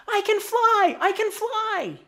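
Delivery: very high, loud gleeful cry; excited yell. A narrator's reading. Little bug flying by overhead, yelling excitedly. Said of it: "yelling excitedly".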